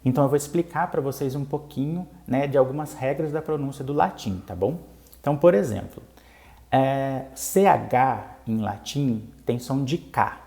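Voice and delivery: ordinary talk, nothing marked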